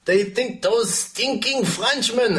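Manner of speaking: slow, a light hint of a French accent; put-on French accent. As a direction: sneer it in a mock French accent. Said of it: "That's the wrong accent".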